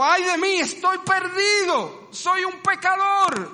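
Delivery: high, loud, slow cry of being lost; a doomed sinner's lament, high and drawn out; anguished cry. Acted. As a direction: wail as one undone before God. Acts Isaiah seeing himself dead.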